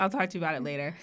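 soft, low phone reply; discreet low aside. Retold or imitated. Promising friend on the phone to talk later, discreet low aside. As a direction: keep it down, for the friend only.

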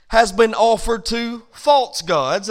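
"false" drawn out and hit hard; slow down and lean firmly on "false"; stress on "false". Shown as a demonstration. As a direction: punch the word "false", loud and deliberate. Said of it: "I stress the word false".

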